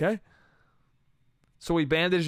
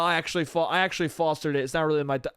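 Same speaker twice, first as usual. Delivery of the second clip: usual speaking voice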